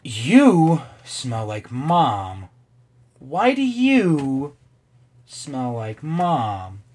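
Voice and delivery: very slow, drawn-out question; slow puzzled drawl; slow wondering. Acted. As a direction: stretch each word out in wonder. Voicing character reading dialogue.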